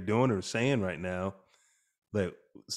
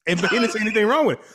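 ordinary talk, nothing marked

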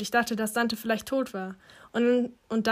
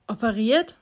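taken aback, louder with a sharp rise; startled question; 5 dB louder than usual. A narrator's reading. Son hears from his mother about the operation, startled question. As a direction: echo it in disbelief, rising at the end. Patient learns he was operated on.

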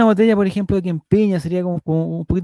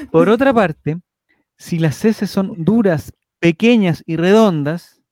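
measured article read; reading aloud. Acted. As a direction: read it like an informative text.

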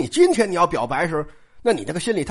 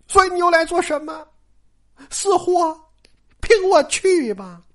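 put-on higher, reproachful girl's voice; jealous Daiyu; 8 semitones higher than usual. Daiyu reproaching Baoyu, high and hurt. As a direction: needle him with wounded jealousy. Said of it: "不是刚才这动作吗".

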